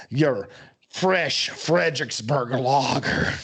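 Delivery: slow, drawn-out German accent; mock German, words stretched out. Shown as a demonstration. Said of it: "That's my German accent".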